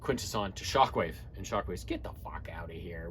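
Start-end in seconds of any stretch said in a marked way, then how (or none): 1.8-3.1 hushed, breathy brush-off; whispered retort. Retold or imitated. Shockwave turning the pig guy away, whispered. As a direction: hiss it under the breath as a cold dismissal.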